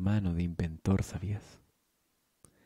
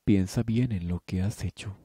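ordinary delivery

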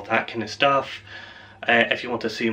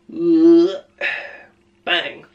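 gunshot sound; 5 semitones higher, 6 dB louder than usual. Pretend. Mimicking a gunshot from rifle.

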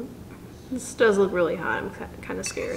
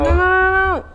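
high, loud "no"s; loud protest; 10 semitones higher, 10 dB louder than usual. Real, not acted. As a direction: cry out to stop him. Stopping him eating.

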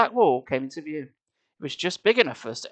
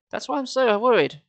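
usual speaking voice

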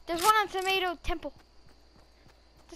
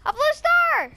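higher, bigger excited shout; more excited; 9 semitones higher, 7 dB louder than usual. Real, not acted. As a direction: top the first call with more thrill. Repeat, higher and louder.

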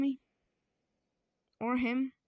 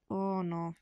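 normal talking voice

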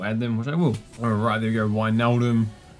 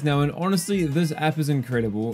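upbeat, higher sponsor read; 5 semitones higher than usual. Acted. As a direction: sell it like a YouTube ad.

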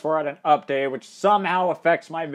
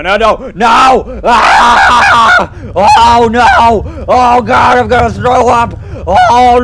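screaming; 8 semitones higher, 13 dB louder, slower than usual. Real, not acted. Screaming on the roller coaster.

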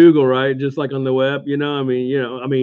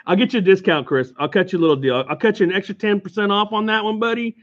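ordinary delivery

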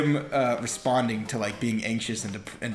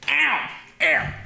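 high yelps of pain; mock ow; 13 semitones higher than usual. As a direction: yelp it out.

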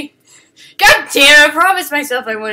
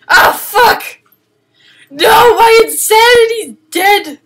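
high, loud cry of dismay; shocked shout; 13 semitones higher, 14 dB louder, slower than usual. Real, not acted. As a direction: yell it as everything collapses. Character's sanity runs out.

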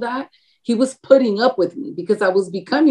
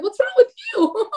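squeaky mimicking echo of his words; mocking echo. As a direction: echo it back way up high, mocking. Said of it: "And I'm like".

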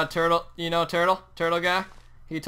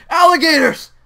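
high loud excited yell; excited shout; 12 semitones higher, 15 dB louder than usual. Real, not acted. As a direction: shout it out on first sighting. Spots alligators in game.

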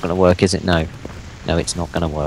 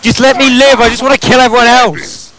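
exasperated outburst; 6 semitones higher than usual. Real, not acted. Gamer begging John to let him live, exasperated. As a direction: cry out in real exasperation at a teammate.

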